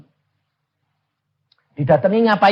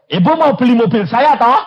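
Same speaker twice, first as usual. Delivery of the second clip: high, loud asking; eager blunt question; 13 semitones higher, 9 dB louder than usual. Retold or imitated. Preacher asking the widow to buy his car, high and loud. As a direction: ask straight out with excitement.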